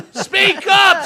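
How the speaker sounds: high, loud call to speak up; jury shouting. Retold or imitated. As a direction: yell it from the jury box. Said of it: "says the jury".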